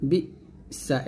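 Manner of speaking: "বি" cut short, a pause, then "সা"; correct hamza stop. Shown as a demonstration. Teacher repeating the right way, stopped and separated. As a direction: stop sharply after "বি", then "সা". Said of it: "এরকম".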